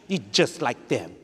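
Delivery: slow soft scornful put-down; a touch of a scornful mother; mock contempt. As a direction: let the disdain drip. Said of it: "You think she was telling him that".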